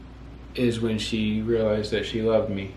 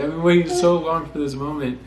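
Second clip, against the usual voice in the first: high, louder, giddy thought; excited inner voice; 6 semitones higher, 5 dB louder than usual. Retold or imitated. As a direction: gush it like a secret thrill. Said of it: "but in my mind, I was like".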